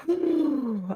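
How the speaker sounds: long, soft exhaled sigh; heavy sigh. Real, not acted. Host trying to stay on track, sighing heavily. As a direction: blow out a breath to regain composure.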